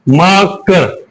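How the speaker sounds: Marker broken into two beats, mar, ker; marker with a gap between its two parts; split into syllables. Shown as a demonstration. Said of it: "pronounced in two attempts".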